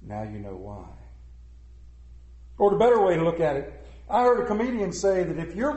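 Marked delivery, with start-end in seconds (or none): 0.0-0.9 very quiet, low reply; hushed, much lower answer; Khrushchev. Retold or imitated. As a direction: drop low and soft, letting it land. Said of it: "replied quietly".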